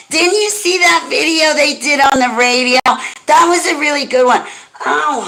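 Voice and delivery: high, sweet condescending church-lady voice; mock the pious scold with syrupy pity; sugary mocking church lady. Mocking churchgoers scolding Holly, in a high sugary voice.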